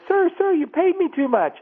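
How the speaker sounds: high mock female voice, urgent; imitated waitress. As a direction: play the anxious waitress calling after him. Waitress voiced in retold story.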